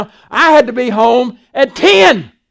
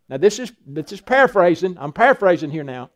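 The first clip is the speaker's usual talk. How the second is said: ordinary delivery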